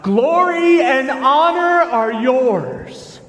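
very slow, high, loud cry to heaven; all creation shouting in worship; crying out praise. Acted. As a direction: belt it out, stretching every word. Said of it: "all of creation cry out to God".

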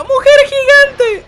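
very high, drawn-out fan yell; shouting. Retold or imitated. Imitating a fan yelling a request at the DJ, shrieked. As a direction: scream the song request from the crowd. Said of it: "Y alguien gritaba".

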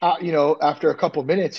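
usual speaking voice

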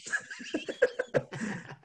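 real laugh bursting out after the punchline. Real, not acted. Storyteller cracking up at the pitcher's insult, laughing. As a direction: crack up at your own story.

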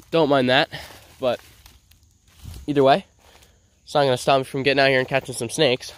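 usual speaking voice

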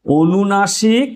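অনুনাসিক stretched very slowly, syllable by syllable; slow dictation of অনুনাসিক for a class to echo; drawn out. Shown as a demonstration. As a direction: spell out অনুনাসিক by ear, "o-nu-naa-shik". Teacher repeats term for class.

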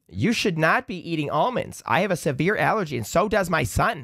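high-pitched mock scolding from an allergic stranger; mock yelling lady. Lady scolding a stranger eating almonds, high mock scold. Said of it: "started yelling at me".

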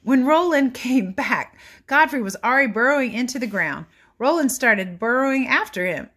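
put-on lively read-aloud for children; animated picture book narration; storybook reading. A narrator's reading. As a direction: read it like bedtime story time.

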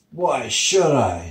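slow, loud sulky retort. Acted. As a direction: drag it out like a sulking sun. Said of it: "said the sun sulkily".

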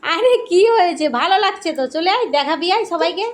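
loud, high excited praise for the pet. Real, not acted. Cheering the dressed-up pet. (উচ্ছ্বসিত জোরে প্রশংসা)

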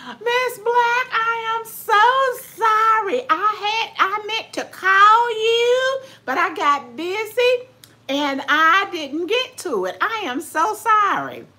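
high, slow, sweetly apologetic mock voice; imitating apologetic caller. Imitating the contractor's receptionist apologizing, high and sugary. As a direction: put on a sugary sorry voice. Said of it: "She called this morning".

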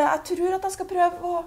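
high, loud held-up call. Acted. Medium shows a hesitant sentence shouted from a mountain, calling out. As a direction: call it out high across a valley. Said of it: "Så når du roper".

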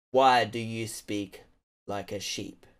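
"Why do you speak" drawn out slowly, word by word; slow, deliberate questioning. Retold or imitated. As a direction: ask slowly and low, as if to someone dim. Asks again, slowly spelling out.

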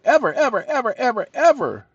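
each ever pitched high and punched; emphatic repetition. Real, not acted. Host insisting to viewers never to trust it.

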